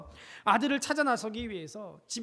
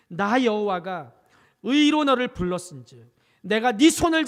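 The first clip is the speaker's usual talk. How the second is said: scripture reading; 8 dB louder than usual. Acted. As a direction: proclaim the verse with full weight. Reads scripture aloud.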